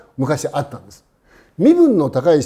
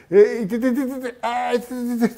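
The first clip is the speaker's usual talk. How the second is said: in pain; 7 semitones higher than usual. Retold or imitated. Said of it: "と言ってやってきたわけですよ".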